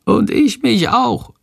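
high, slow tiny creature voice; a small bat chiming in, pitched high and gentle; little bat voice. A narrator's reading. Shandor the bat joins in, in a high tiny voice. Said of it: "flüstert leise Shandor".

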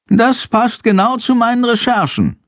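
high-pitched little bat character voice; Shandor the bat. A narrator's reading. Bat to boy about his research, high creature voice. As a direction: squeak it out high, as a talking bat. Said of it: "raunt Shandor".

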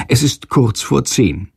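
ordinary delivery

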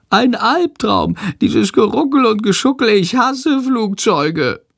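high, drawn-out creature wail; whining. A narrator's reading. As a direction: moan every word out slowly and high. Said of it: "jammert Shandor". (jammernd, hoch und gedehnt)

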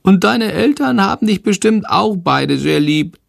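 high, bright-eyed little bat voice, chattering hectically; a flustered little bat calling out; high squeaky bat creature; little bat Shandor. Acted.

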